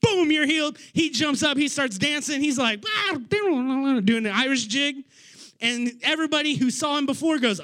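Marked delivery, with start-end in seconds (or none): 2.9-3.2 a mock yelp, much higher; a happy cry as he leaps up; joyful whoop. Healed man cheers.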